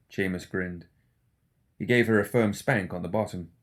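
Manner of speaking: ordinary delivery, nothing marked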